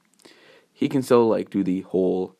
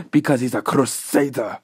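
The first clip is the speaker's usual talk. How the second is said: higher-pitched mock German accent; put-on German accent; 4 semitones higher than usual. Narrator explaining why he still tries, playful German accent. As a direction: declare it proudly in a German accent. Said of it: "Also, I like that accent".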